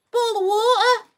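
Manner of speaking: ordinary delivery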